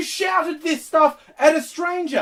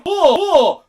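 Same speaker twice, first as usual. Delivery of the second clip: mock shocked gasps; 5 dB louder than usual. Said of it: "like these guys go".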